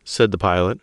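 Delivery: ordinary delivery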